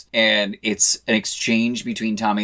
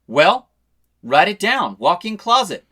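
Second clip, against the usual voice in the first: higher and louder, pressing the point; bossy, raised-voice order; insistent demand; 9 semitones higher, 7 dB louder than usual. Retold or imitated. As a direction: hammer the demand home. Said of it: "And she goes".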